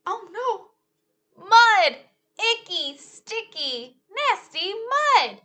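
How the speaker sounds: mock-alarmed storytime cry. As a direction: exclaim as if spotting danger. Disgust at mud.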